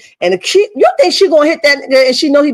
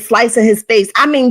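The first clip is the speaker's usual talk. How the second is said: ordinary talk, nothing marked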